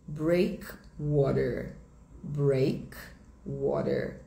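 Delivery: American English, slow and clear, R sounded in "breakwater"; sotaque americano. Shown as a demonstration. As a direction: say "breakwater" slowly with an American R ending. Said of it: "Em inglês americano". (sotaque americano, devagar, com o R final)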